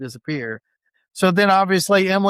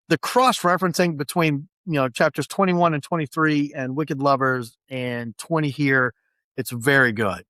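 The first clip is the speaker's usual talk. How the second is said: ordinary talk, nothing marked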